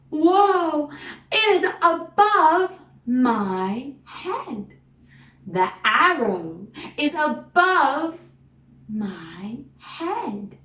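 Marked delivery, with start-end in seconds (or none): 0.1-4.7 excited. Acted. Arrow goes above her head. 8.9-10.6 my head whispered with no voice; whispering. Acted. Whispered after excited line.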